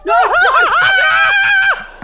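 high loud laughing yell; laughing shout. Real, not acted. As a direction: shriek it through laughter. Laughing panic at the ghost.

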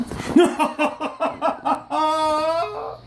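high giggling; laughing. Real, not acted. After the boiling blooper, high giggling laughter. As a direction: giggle helplessly.

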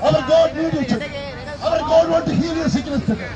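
ordinary talk

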